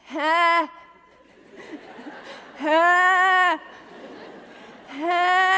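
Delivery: strangled nightmare wail, drawn out and loud; sleeping cry for help. Shown as a demonstration. Woman shows friends' audience her nightmare noise, loud strangled cry for help. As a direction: imitate trying to scream in a dream, stuck on one wordless vowel. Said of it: "I'm saying something like this".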